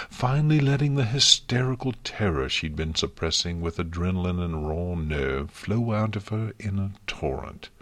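usual speaking voice

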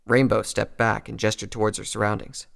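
plain reading voice

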